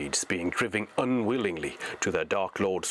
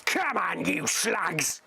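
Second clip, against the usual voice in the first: much higher snarling orc voice; bully the slugs to their feet; orc crying out; 9 semitones higher, much slower than usual. Acted. Orc driver hurries the hobbits, high snarling shout. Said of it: "he cried".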